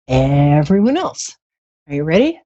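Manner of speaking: ordinary delivery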